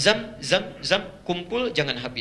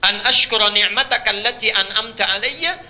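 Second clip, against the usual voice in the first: raised, chanted Arabic prayer; prayer recitation; 6 semitones higher, 6 dB louder than usual. Acted. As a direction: sing it out as a plea.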